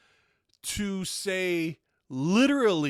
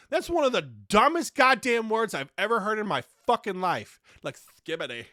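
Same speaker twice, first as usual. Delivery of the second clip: ordinary talk, nothing marked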